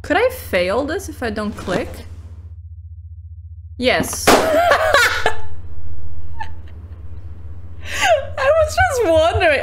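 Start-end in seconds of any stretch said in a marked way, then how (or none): 4.3-9.6 high giggling words; laughing. Real, not acted. Player laughs at game outcome.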